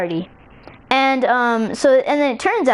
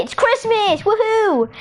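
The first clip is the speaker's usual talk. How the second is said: high, festive whoop; excited cheer; 10 semitones higher than usual. Acted. Storyteller announces Christmas morning, excited cheer.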